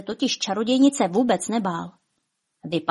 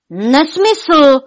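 loud, raised roar of protest; 7 semitones higher, 9 dB louder than usual. A narrator's reading. Giant Vladimír rejects Špuntík's guess, roaring. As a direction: roar back at the wrong guess. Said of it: "zařval Vladimír".

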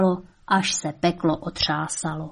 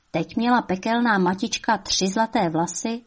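usual speaking voice

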